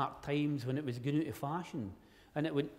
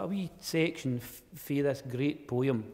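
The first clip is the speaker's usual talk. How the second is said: ordinary talk, nothing marked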